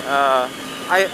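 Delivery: usual speaking voice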